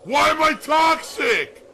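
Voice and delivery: screamed very high and loud, slowly; mock outrage. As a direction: shriek it like a drama queen. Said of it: "we're just gonna keep saying".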